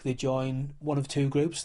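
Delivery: normal talking voice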